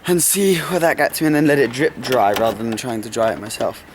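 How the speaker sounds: ordinary delivery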